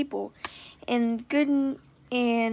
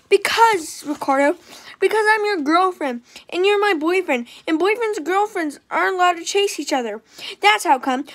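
put-on higher, louder little-girl voice; bossy little girl; 5 semitones higher, 5 dB louder than usual. A narrator's reading. Girl explaining the rules to her boyfriend, bossy and higher. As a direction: lay down the rules like a bossy kid.

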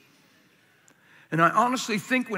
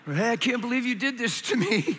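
silly mock complaint; 5 semitones higher than usual. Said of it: "I'm being silly, man".

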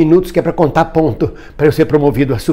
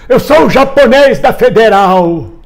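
high, drawn-out mock chant; imitated street shout; 5 semitones higher than usual. Man recalling slogans he yelled in the streets, mock shouting. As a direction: proclaim it slowly to the street. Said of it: "gritava nas ruas assim".

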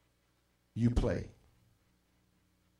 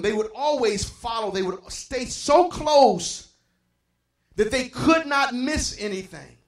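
higher, louder, driving sermon delivery; full-force preaching; 6 semitones higher, 5 dB louder than usual. Real, not acted. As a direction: build with fervor, hammering each repeated phrase.

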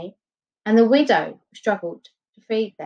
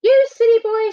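high, loud scolding mother; angry mother; 14 semitones higher, 5 dB louder than usual. A narrator's reading. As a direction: scold him, high and sharp. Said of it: "she cried".

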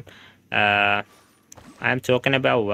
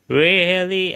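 sharp, high, louder disbelieving "Really?"; surprised; 7 semitones higher, 4 dB louder than usual. Real, not acted. Streamer reacting to chat calling her accent mixed, surprised. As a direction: genuinely caught off guard, pitch jumping up.

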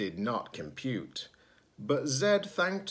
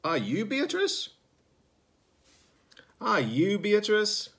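much higher, polite little robot; young robot voice; 11 semitones higher, 4 dB louder than usual. Acted. Zed asking a stranger for Beatrice, much higher and polite. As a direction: ask it up high and politely.

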